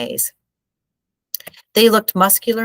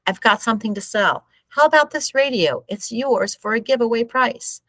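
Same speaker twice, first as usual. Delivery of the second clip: hawker's pitch; 6 semitones higher than usual. A narrator's reading. Hawker selling a radio.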